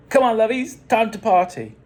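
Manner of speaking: loud, high party host call. A narrator's reading. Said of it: "Dennis called out".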